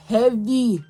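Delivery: ordinary talk, nothing marked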